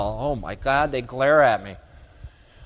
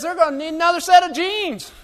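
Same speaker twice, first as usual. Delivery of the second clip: much higher hammed-up farmer voice; mocking Jim Bob; 12 semitones higher, 4 dB louder than usual. Farmer's joke about jeans, high mocking voice. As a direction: squeak the punchline as the folksy farmer. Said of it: "as much as I'm mocking Jim Bob".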